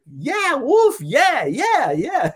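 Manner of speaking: put-on high, excited girls cheering. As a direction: squeal it like swooning fans. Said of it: "all these girls are like".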